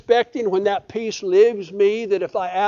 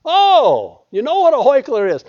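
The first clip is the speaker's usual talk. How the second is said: much louder and higher playful jab; loud teasing; 7 semitones higher, 7 dB louder than usual. Acted. Preacher ribbing the congregation about a hard word, loud. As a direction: tease the congregation loudly.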